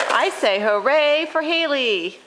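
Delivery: ordinary talk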